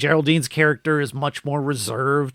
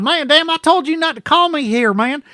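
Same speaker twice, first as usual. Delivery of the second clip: put-on silly Southern accent; 11 semitones higher than usual. Mocking the movie's accent to his cohost, hammed-up Southern voice. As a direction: ham up a thick Southern accent for laughs. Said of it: "a kind of voice I do when I'm really being silly".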